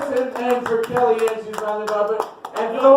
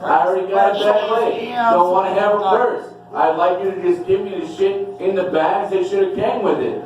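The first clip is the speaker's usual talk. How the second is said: ordinary talk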